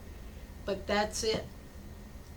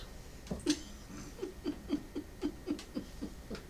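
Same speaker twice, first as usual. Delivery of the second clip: long, soft, breathy chuckle; amused laugh; 6 semitones higher, 9 dB quieter than usual. Real, not acted. Storyteller enjoying her own joke, amused real laugh. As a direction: chuckle at your own punchline.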